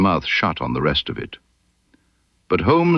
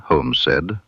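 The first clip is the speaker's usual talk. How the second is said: plain reading voice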